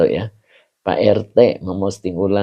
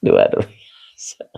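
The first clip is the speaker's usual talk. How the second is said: breathy whispered aside, slower; 10 semitones lower, much slower than usual. Real, not acted. After laughing at a viewer's name, a slow whispered aside. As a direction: hush it out under the breath, slowly.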